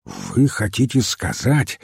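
ordinary delivery, nothing marked

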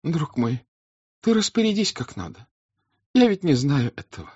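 a high, meek, apologetic voice; guilty countess. Acted. The countess yielding to her husband, guiltily. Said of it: "виновато опуская глаза".

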